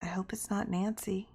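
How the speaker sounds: a fearful hush; whispered. A narrator's reading. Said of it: "A girl whispered".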